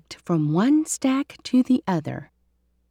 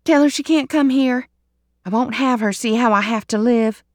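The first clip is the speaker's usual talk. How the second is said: higher, louder, ashamed insistence; upset refusal; 5 semitones higher, 5 dB louder than usual. Acted. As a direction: push the visitor away. Emma Lou refuses the visit.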